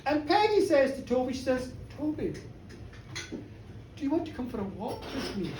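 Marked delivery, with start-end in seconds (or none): 2.0-5.5 hushed, softly spoken invitation; soft coy invitation. Acted. Peggy asks Toby out for a walk, soft and coy. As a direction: ask it quietly, as a shy come-on.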